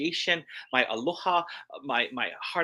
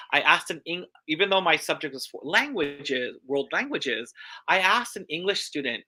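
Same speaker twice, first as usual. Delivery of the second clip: ordinary talk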